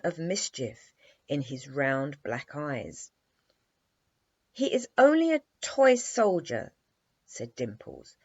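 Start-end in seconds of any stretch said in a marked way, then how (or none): 4.5-6.7 high, loud dismissive toss-off; a light mock scorn; scornful. A narrator's reading. Dimples dismissing the toy soldier, high scornful retort. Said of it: "tossing her head".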